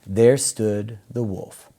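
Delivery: ordinary delivery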